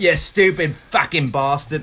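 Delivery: loud, slow mock Cockney accent. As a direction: throw the insult in Cockney. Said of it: "terrible Cockney accent".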